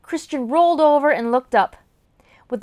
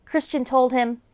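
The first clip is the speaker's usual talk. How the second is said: ordinary delivery, nothing marked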